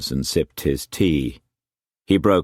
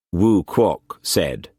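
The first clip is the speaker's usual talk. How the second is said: ordinary delivery, nothing marked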